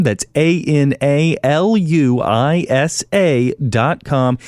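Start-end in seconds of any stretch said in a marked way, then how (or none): 0.3-3.5 slow, letter by letter; spelling out. Shown as a demonstration. Host spelling the sponsor's name slowly for listeners. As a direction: spell each letter out clearly. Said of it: "go to ana luisa dot com".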